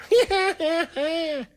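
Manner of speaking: irritating high-pitched cackle; put-on laugh, way up high; Tom Cruise laugh. Capping the Steve imitation for a friend, high mock cackle. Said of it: "that high laugh".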